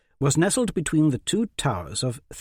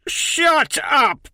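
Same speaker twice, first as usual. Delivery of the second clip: much higher, louder snap; angry bellow; 14 semitones higher, 5 dB louder than usual. A narrator's reading. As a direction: cut him off in fury. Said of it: "Jovan bellowed".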